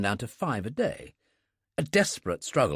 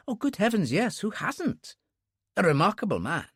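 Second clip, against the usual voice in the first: much higher, gushing delight; a young fan of the author; enthusiastic clerk; 7 semitones higher than usual. Acted.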